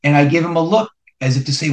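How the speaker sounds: usual speaking voice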